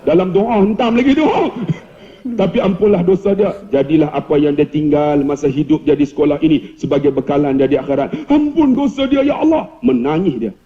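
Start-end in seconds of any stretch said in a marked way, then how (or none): 2.4-9.7 prayer recitation. Acted. Ustaz begs forgiveness for principal, intoned doa. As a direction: pray earnestly, building at the end. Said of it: "saya baca doa".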